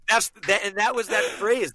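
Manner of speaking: ordinary talk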